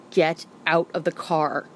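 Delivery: dead calm, no room for argument; a mother's steady, grim order; deadly serious. A narrator's reading. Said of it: "deadly serious".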